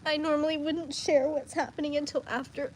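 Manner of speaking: ordinary talk, nothing marked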